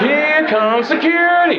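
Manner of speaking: high squeaky announcement of security; mock alarm. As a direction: squeal it out like a panicked alert.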